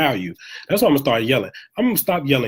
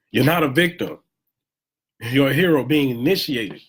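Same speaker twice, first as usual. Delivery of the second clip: normal talking voice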